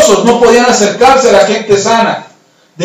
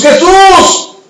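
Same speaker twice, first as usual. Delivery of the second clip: high, drawn-out plea for healing; long, high imploring shouts; crying out; 8 semitones higher, much slower than usual. Acted. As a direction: call out to someone far away. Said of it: "empiezan a gritarle a Jesús".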